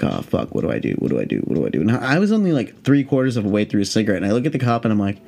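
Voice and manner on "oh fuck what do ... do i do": very low, half-voiced fretting under the breath; hushed mock panic, dropped low; imitated anxious panic. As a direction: mimic a nervous person repeating themselves. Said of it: "super bad anxiety".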